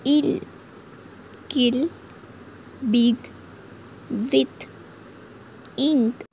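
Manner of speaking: short i in इल, किल, बिग, विथ, इंक. Shown as a demonstration. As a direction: say each word singly with a quick, short इ. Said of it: "ह्रस्व उच्चारण". (इल, किल, बिग, विथ, इंक में ह्रस्व इ)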